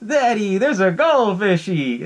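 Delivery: high, drawn-out mock child voice; little daughter. Daughter calling to her daddy, high mock little-girl voice. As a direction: mimic a small girl calling out, hammed-up. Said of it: "his daughter".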